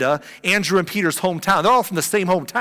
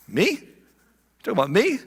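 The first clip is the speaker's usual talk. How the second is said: higher, mock disbelief; mock surprised; 4 semitones higher than usual. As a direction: point at yourself, surprised. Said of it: "Nathanael's doing one of these".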